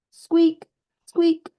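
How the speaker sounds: high squeaky creaking noise; playful high squeak sound; squeaky merry-go-round. Acted. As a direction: squeak it high like a turning ride.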